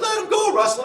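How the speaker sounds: high shrill-pitched mock woman's yell; coworker yelling. Coworker begs him to release the man, high mock yell. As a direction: squeal it like a panicked coworker. Coworker voiced in retold scuffle.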